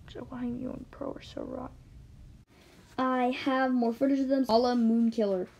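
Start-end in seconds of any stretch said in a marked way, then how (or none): 0.1-1.7 soft, breathy whispered question; whispered aside. Real, not acted. Vlogger whispering to viewers about the bullies, hushed. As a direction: whisper it under your breath. Aside after reading chat.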